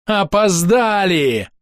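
high, drawn-out cry of anger; slow, stretched, high-pitched angry call. A narrator's reading. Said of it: "гневно крикнул Корнет".

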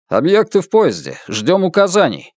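officer's voice over the radio; radio report. Acted.

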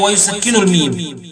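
ordinary talk

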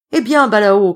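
loud call up to the trees; a loud shout of his name. A narrator's reading. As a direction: yell to him from below. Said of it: "crient les trois frères".

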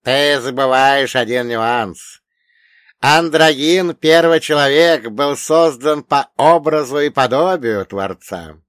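put-on loud, high old man, emphatic; old dead mentor. A narrator's reading. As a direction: correct your pupil as the dead elder. Dead old man corrects the hero.

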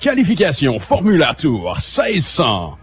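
put-on race-track loudspeaker voice; mock megaphone announcer. Radio host answering co-host's wish for a megaphone, mock announcer voice. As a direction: boom out the schedule like a pretend track announcer.

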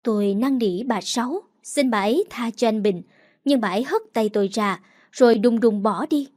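ordinary delivery, nothing marked

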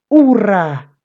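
high, loud hurrah; big drawn-out hooray; joyful cheer. A narrator's reading. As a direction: cheer like a delighted dad. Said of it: "dijo papá".

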